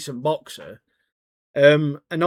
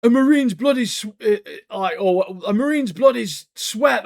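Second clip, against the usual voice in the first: a higher, hammed-up proud recital; mock proud marine; 7 semitones higher, 4 dB louder than usual. Wu Jin boasting about marines to his senior, hammed-up pride. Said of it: "and he's like".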